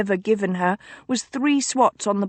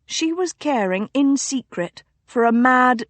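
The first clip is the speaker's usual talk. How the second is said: ordinary delivery, nothing marked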